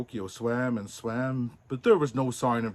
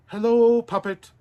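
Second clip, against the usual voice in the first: high, loud welcoming call; 11 semitones higher, 9 dB louder than usual. Acted. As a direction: call him over to join. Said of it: "the performers called".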